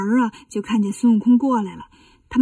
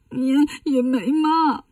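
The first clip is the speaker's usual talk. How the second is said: slow, halting mock sob; tearful; slower than usual. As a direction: stammer it out between put-on sniffles. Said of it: "那你哭啥呀". (抽抽搭搭地哭着说)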